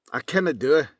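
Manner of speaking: "canna" in broad Scots, pushed with feeling; Scottish accent. Shown as a demonstration. Voice actor tries a Scottish accent for viewers, broad Scots. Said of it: "Now as if I'm Scottish".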